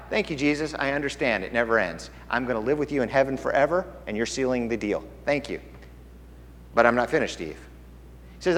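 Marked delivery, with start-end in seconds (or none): 0.1-5.6 low, soft, grateful reply; quiet thanks. Acted. Believer thanking Jesus for eternal life, quietly grateful. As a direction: drop down into a hushed personal thank-you.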